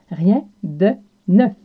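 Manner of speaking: Rien de neuf, lentement et posément; répétez Rien de neuf lentement; slow model phrase. Shown as a demonstration. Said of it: "le brise-glace du jour qui est". (lent et articulé)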